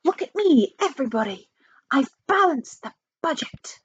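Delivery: high, excited announcement; triumphant cry. Acted. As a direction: call everyone to look, proudly. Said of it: "cried the chief financial advisor".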